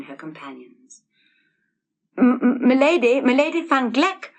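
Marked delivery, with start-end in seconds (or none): 2.2-4.3 stammering loud call after her. A narrator's reading. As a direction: call her back, louder and higher, tripping on the start. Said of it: "called Hans in a loud tone".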